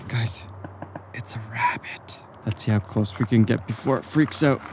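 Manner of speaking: hushed whisper near a rabbit. Real, not acted. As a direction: whisper so it won't run. Sneaking up on a rabbit.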